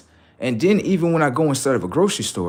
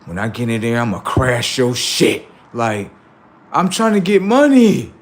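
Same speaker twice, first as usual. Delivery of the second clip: mock loud hustle; 3 semitones higher, 6 dB louder than usual. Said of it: "What you doing?".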